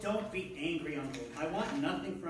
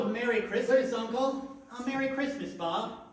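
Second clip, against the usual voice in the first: bright, loud festive hello, pitched up; hearty greeting; 6 semitones higher, 7 dB louder than usual. Acted. Nephew bursting into the office, calling a loud cheery greeting to his uncle. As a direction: burst in full of holiday cheer.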